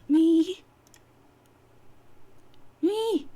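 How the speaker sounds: loud, high disbelieving squeak; startled me. Acted. John startled that Nana means him, loud and high. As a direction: blurt it out in shock.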